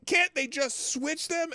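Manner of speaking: high, slow, exasperated mock plea. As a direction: stretch it out in disbelief. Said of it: "I'm like".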